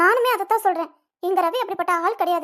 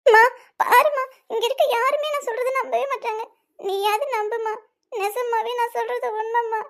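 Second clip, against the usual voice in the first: sobbing appeal, slowing at the end; tearful pleading. Acted. Daughter begging mother to believe her, tearful pleading. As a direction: plead through tears to be believed. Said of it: "நீ எதுக்கு அழுதுட்டு இருக்க".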